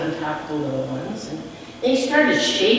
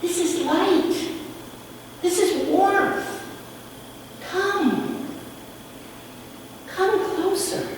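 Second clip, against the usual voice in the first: gentle stranger; 11 semitones higher than usual. A narrator's reading. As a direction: coax them kindly toward the fire. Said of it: "And he said".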